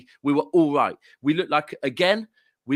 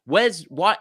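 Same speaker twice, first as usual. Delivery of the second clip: ordinary talk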